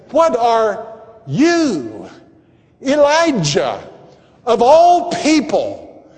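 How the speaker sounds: "you" set apart by pauses and drawn out; single out "you" in a loud, high challenge; incredulous emphasis landing on "you"; stress on you. Acted. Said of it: "put the emphasis on the word".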